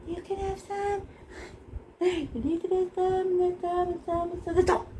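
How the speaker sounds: dole it out like treats; playful giving. Pretend. Teacher handing out paint specks in a high sing-song.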